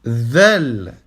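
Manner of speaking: Zel with the tongue between the teeth, like English th in this. Shown as a demonstration. Teacher modelling Zel, tongue between teeth. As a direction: buzz Zel through the teeth. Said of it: "langue entre les dents".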